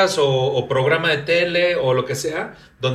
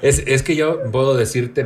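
usual speaking voice

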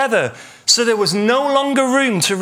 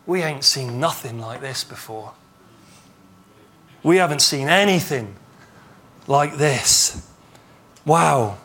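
hushed amazement; 8 semitones lower than usual. Acted. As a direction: let the amazement drop into a low hush. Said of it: "So they were amazed".

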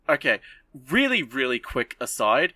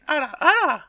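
much higher, louder anime coo; ara ara voice; 6 dB louder than usual. Retold or imitated. Stardust Witch cooing during the fight, high anime tease. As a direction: tease like an anime older sister. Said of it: "the Stardust Witch, of course, goes like".

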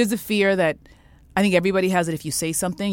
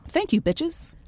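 much higher, playful sassy send-off; sassy thank-you to the former bosses; cheeky sign-off; 11 semitones higher, slower than usual. Real, not acted. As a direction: toss it off with sass.

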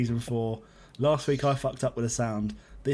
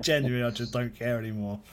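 ordinary delivery

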